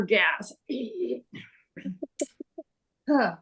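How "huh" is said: usual speaking voice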